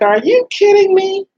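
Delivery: high, loud, incredulous cry; outraged disbelief. Retold or imitated. Speaker hearing of the pastor's prophecy, crying out high and loud in outrage. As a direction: shout it in shocked disbelief. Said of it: "And I was like".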